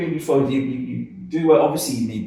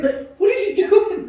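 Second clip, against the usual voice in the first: much higher, puzzled mock question; baffled squeak. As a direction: squeal it in disbelief. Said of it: "and I was like".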